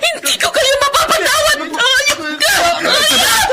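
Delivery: high, raging shouts with sobs; furious screaming. Acted. Sister screaming at her sister's attackers in jail. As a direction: scream curses at them through tears.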